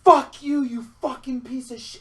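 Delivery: high outburst, loud on the first words; mock-furious swearing, pitched high; retold angry cussing. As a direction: cuss out a spam caller, hammed-up. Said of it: "just start cussing it".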